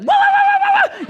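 mock wail, very high and very loud; hammed-up high wailing yell; Pentecostal yelling. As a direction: imitate a woman yelling in the Spirit at full force. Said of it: "in church and be like".

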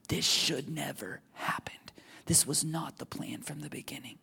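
soft whisper of sorrow; grieving whisper. Acted. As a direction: hush it like a secret grief. Voicing Jesus' grief, whispered.

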